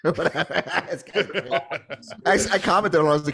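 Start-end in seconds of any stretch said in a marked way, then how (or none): 0.1-1.5 laughing. Real, not acted. Laughing over the clip.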